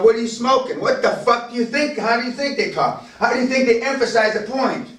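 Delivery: incredulous challenge. Acted. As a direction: challenge them with heated rhetorical questions. Heated rhetorical questions about tough talk.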